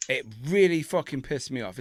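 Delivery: ordinary delivery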